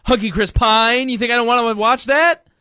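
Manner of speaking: high protest climbing to a cry; indignant rant. Acted. Man demanding to watch Chris Pine, rising indignation. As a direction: build mock outrage toward a squeal. Comic rant builds to outrage.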